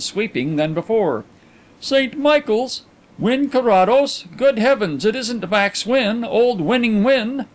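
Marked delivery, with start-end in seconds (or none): 1.8-7.5 high, drawn-out astonishment; amazed recognition. A narrator's reading. Visitor recognizes an old school friend, amazed. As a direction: exclaim as an old friend dawns on you.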